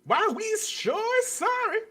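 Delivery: sarcastic mock apology; mock servile apology. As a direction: ham up a grovelling apology.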